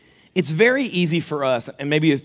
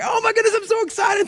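much higher, gushing excitement; excited squeal. Retold or imitated. Wife thrilled about her surprise gift, high excited squeal.

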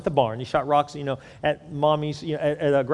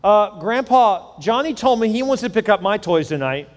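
higher, louder sing-song call; yelling out; 5 semitones higher, 4 dB louder than usual. Retold or imitated. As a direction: call out slyly to grandpa. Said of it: "yells out from the living room".

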